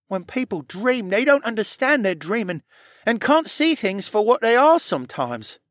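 very high character voice with a shrug; Rylan explaining. Acted.

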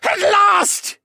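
very high, loud villain elation; triumphant cry. A narrator's reading. Villain rushes into the waters, crying out triumphantly. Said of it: "Vane cried".

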